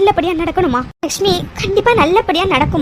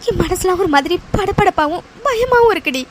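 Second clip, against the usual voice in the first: ordinary talk, nothing marked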